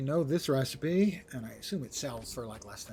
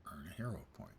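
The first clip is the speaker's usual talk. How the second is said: whispering; 9 semitones lower, 11 dB quieter, faster than usual. Real, not acted. Whispered aside to self.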